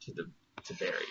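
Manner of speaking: soft unvoiced whisper, quick. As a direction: breathe it secretly. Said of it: "whisper in Dave's ear".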